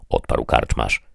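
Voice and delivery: ordinary delivery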